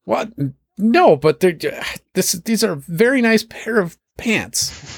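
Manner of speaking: very high, fussy character voice; a dandy defending his pants; prissy NPC. Acted.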